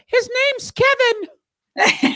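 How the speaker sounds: high, slow mock heckler voice; mock audience voice; audience member. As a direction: squeak it out like a smug heckler. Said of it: "someone in the audience said".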